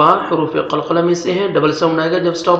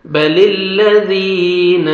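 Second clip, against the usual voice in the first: slow, melodic tajweed chanting; Quran recitation; 3 dB louder, much slower than usual. Shown as a demonstration. Teacher reciting the verse for students to repeat.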